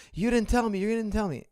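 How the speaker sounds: a retold child's wail, pitched high; upset little daughter. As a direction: play the crying five-year-old accusing her papa. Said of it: "She was crying. She was furious".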